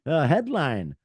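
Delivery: ordinary talk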